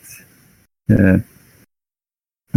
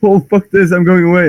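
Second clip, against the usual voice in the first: exasperated mock whine, higher and louder; fed-up alien; 10 semitones higher, 4 dB louder than usual. The Eldar storming off from Ferrus, hammed-up exasperation. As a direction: give up in a huff. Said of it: "the Eldar guy is".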